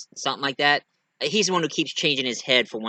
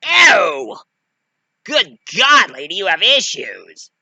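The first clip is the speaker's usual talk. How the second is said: loud, high recoil; hammed-up revulsion; disgusted outburst; 6 semitones higher, 9 dB louder, slower than usual. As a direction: cry out grossed-out, then scold. Chucky reacts to coughed-up leech.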